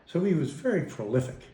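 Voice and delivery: normal talking voice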